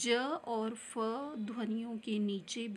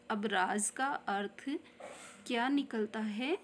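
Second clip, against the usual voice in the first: ordinary talk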